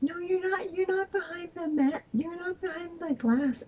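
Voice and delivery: very high, insistent imitation; mock nagging cashier. Cashier contradicting customer about the glass, mock nagging.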